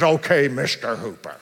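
a frosty lawyer's "Okay, Mister Hooper"; curt dismissal. Retold or imitated. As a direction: cut him off coolly, like he's already dismissed. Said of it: "Like, just like that".

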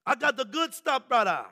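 emphatic imitated Jamaican accent, punchy ending; rusty Jamaican accent. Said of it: "a little rusty on my Jamaican ask, uh, uh, accent".

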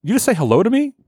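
mock the stranger's disbelief; indignant stranger. Stranger confronts the greeter.

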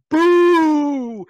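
long, high, loud mock boo; imitated booing. Lone heckler to the athletic director, booing loudly. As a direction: boo him with one drawn-out holler. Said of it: "it's just him yelling".

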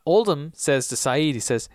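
normal talking voice

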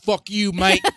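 loud, high-pitched Australian outburst; hammed-up Aussie accent. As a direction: put on a brash, mock Australian yell. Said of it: "did an Australian accent".

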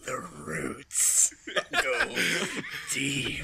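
very slow, low and drawn out; hushed secret message. Acted. Finishing the message in Darian's ear, hushed and low. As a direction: intone it low, word by word. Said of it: "very quietly whisper into Darian's ear".

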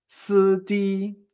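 SIJI con dos sílabas altas y planas; tono uno uno. Shown as a demonstration. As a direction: alarga SIJI en una misma nota. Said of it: "Fíjate, uno uno". («siji» alto y sostenido, como robot)

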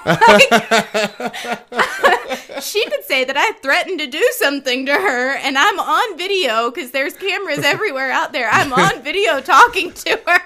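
talking through loud laughter; laughing. Real, not acted. Telling friend about fearing the principal, laughing loudly. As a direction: laugh while you confess the worry. Laughing retelling her panic.

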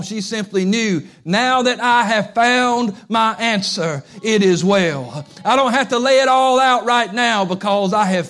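loud, rising preacher cadence; preaching at full force. Acted. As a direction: build it up like a revival sermon.

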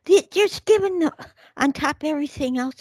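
high-pitched, rapid, incredulous outburst; worked-up disbelief. Real, not acted. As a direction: splutter it out high and fast, outraged. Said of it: "Okay, let me calm down".

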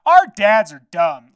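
loud, slow, mocking kid voice; drawn-out jeer of bored kids. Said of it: "the kids will be like".

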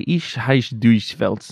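slow, made-up German phrase; drawn-out pretend German; fake German. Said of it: "Sounds like fake German to me".

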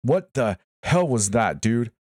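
high, slow, emphatic outburst; exclaiming. A narrator's reading. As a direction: exclaim it in heated disbelief. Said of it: "muffled voice of Kevin exclaim".

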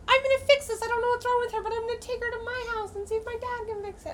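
put-on high-pitched child rushing out a plan; eager little kid. Child telling herself she will fix the dead cat, eager high voice. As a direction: imitate your younger self, high and earnest. Child self in retold story.

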